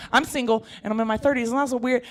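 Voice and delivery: ordinary talk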